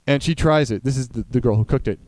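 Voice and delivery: ordinary talk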